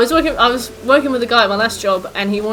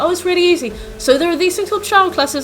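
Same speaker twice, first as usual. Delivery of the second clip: much higher, mock patronising voice; condescending helper; 9 semitones higher, 3 dB louder than usual. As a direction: talk down to her like it's obvious. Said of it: "and then they're like".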